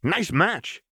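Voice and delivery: high, gleeful crow; Jinx crowing. Acted. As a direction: crow it with manic glee. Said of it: "Jinx crowed".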